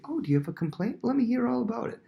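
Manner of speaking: sugary high-pitched invitation; exaggerated interest. Cunning prince inviting a complaint, exaggerated interest. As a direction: climb high and overdo the sympathy. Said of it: "making it a little more obvious".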